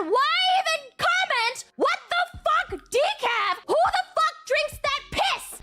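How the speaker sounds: high indignant rant; mock fury at decaf drinkers; mock outrage. Streamer quotes her own angry reply, high mock outrage. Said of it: "and I said".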